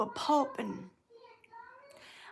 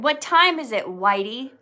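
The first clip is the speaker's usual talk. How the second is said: imitated hostile challenge, raised voice; mock menacing call; 3 semitones higher, 8 dB louder than usual. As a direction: call out like a street tough sizing him up. Voicing attackers in retold story.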